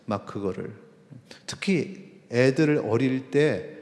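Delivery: ordinary talk, nothing marked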